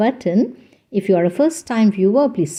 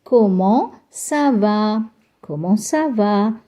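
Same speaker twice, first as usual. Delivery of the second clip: slow model phrase; slower than usual. Shown as a demonstration. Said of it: "So you can repeat it with me".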